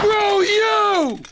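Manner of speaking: shouting at plastic junk; angry at machine. Acted. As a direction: rage at a broken gadget. Man yelling at broken player.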